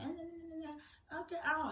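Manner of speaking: high mocking cackle, hammed-up; imitated mocking laugh. Sister laughing at her misfortune from Africa, mock cackle. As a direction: mimic the sister's gloating laughter. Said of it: "my sister here laughing in Africa".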